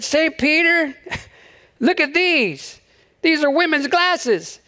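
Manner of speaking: high, slow, eager showing-off; mock thrilled, holding up his find; all excited. Third guy showing Saint Peter his find, high and eager. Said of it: "he got all excited".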